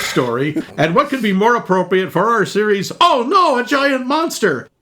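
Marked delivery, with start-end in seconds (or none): 3.0-4.7 mock alarm. Host announces monster-movie series, in mock alarm. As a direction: cry out the title in hammed-up horror.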